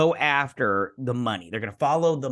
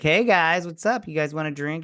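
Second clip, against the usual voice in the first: higher-pitched mock chirpy bartender; bartender greeting; 5 semitones higher than usual. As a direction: imitate an upbeat bartender's hello. Voices a bartender greeting customers.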